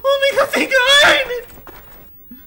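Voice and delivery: high, loud, slow cry of relief; real tearful relief; relieved crying shout. Real, not acted. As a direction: shout with relief after escaping. Relief after escaping.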